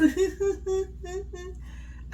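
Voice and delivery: high imitated giggle; imitated laugh. Vlogger imitating Shantae laughing on the show, high giggle. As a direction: mimic her giggling, pitched high. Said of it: "She was".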